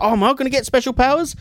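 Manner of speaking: high-pitched eager question, rising at the end; mock eager Kano. Reviewer imitating Kano asking about powers, mock eager. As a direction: put on a high, wishful mock plea.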